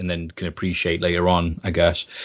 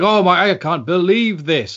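slow, higher, overdone commentator gasp; mock Lou Albano; 9 semitones higher than usual. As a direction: mimic a hammy commentator faking disbelief. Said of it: "Lou Albano as a commentator".

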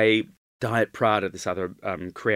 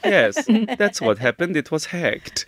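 high, mock Dracula-style accent; hammed-up accent for a feeble excuse; Bela Lugosi; 6 semitones higher than usual. Retelling the designers' apology, mock Lugosi accent. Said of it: "the only accent I can do is Bela Lugosi".

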